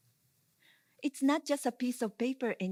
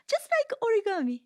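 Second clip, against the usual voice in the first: much higher, quicker, falling at the end; high exclamation; 10 semitones higher, 4 dB louder, faster than usual. Acted. Performer presenting origami to the audience, high exclamation. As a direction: exclaim it high, then let origami drop.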